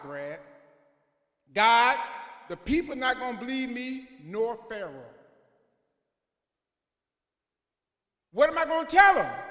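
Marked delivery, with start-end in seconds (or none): 1.5-9.5 loud, high, pleading cry; desperate Moses. Retold or imitated. Moses crying out to God, loud and high. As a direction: Moses shouting his doubts up to heaven. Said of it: "Moses told God".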